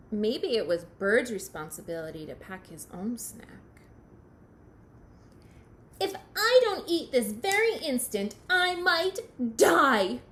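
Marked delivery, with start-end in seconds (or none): 6.0-10.2 hammed-up starving complaint; melodramatic. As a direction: wail it like a drama queen. Starving Bird being dramatic.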